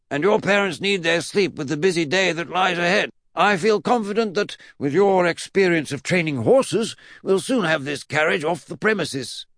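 high, loud eccentric pronouncements; pompous declaration, pitched right up; Mad Uncle Jack. Acted. Great-uncle instructs Eddie, high pompous voice.

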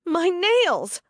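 aghast at a broken nail; indignant gasp. A narrator's reading. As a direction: she finds her broken nail, gasping indignantly. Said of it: "suddenly indignant".

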